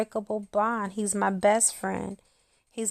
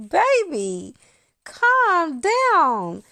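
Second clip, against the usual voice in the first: high, loud imitation of her husband soothing her; imitating husband; 8 semitones higher, 7 dB louder, much slower than usual. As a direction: mimic him telling her to calm down. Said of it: "And he was like".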